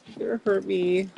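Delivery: high, mocking fearful voice; mock terrified whine. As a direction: mimic a cowering heckler, high.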